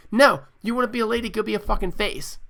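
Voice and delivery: loud, heated fast retort; shouting down the complaint; angry outburst. Real, not acted. Ranting host snaps back at a heel wrestler, loud and heated.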